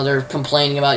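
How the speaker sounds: ordinary talk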